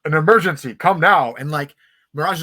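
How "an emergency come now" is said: imitated commanding call, much louder; mock heroic rallying shout; Optimus calling out. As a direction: summon the team like a leader in a crisis. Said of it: "while Optimus is like".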